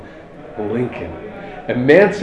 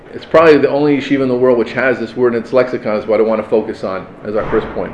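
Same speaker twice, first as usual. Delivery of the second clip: ordinary talk, nothing marked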